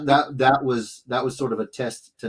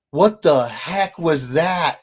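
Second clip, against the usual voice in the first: slow, loud startled question; 4 semitones higher, 5 dB louder, slower than usual. Retold or imitated. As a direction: react as if jolted by a noise. Parent startled by baby noise.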